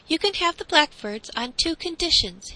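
put-on confident high-pitched boy; bargaining boy. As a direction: set out terms as the boy.

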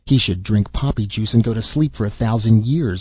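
plain reading voice

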